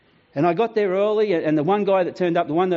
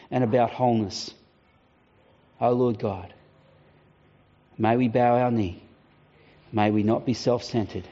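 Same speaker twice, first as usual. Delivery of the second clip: closing prayer; 4 semitones lower than usual. Acted. Pastor leading the congregation in a closing prayer.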